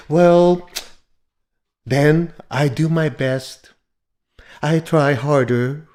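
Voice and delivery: mock-soothing, drawn-out delivery; overly gentle. Shown as a demonstration. Teacher showing how not to talk, too gentle. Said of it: "if you make it so gentle".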